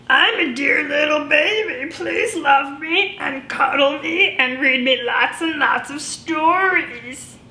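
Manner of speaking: put-on sweet little baby pleading; cute baby voice. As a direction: read the note as a tiny baby. Baby's note read aloud.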